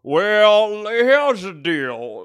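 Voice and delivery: slow loud Southern lawyer; smooth-talk like a courtroom lawyer; honeyed southern lawyer drawl. Acted. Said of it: "Southern lawyer that's trying to get".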